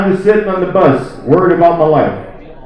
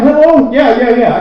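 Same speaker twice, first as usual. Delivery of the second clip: higher-pitched, put-on phone voice; mock phone answering; 6 semitones higher, 3 dB louder than usual. Man faking a call to get away, mock phone answering. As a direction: act out a convenient call, pitched up.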